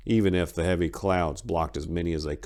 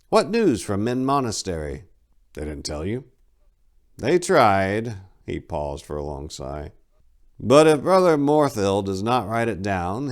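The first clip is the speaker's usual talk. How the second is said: a touch higher and louder for the abbot; lightly voiced abbot; 4 semitones higher, 6 dB louder than usual. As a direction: abbot asking for news, mildly weary.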